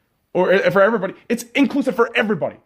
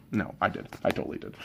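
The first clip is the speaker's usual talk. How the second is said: whispered aside; 13 semitones lower, 13 dB quieter, faster than usual. Real, not acted. Confessing aside.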